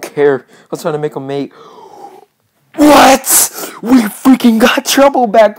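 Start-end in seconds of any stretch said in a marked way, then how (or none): 2.8-5.6 high, loud delighted shout; thrilled yell. Real, not acted. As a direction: explode with real joy. Finds horse again, thrilled.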